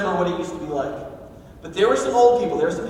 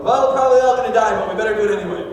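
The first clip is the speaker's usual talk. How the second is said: a hint of a doomed but stubborn hero, loud and quick; lightly voiced grim optimist declaring it anyway; preacher briefly quoting a Narnia pessimist, louder and faster; gloomy Narnia character; 3 semitones higher, 7 dB louder, faster than usual. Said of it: "my favorite Narnia character".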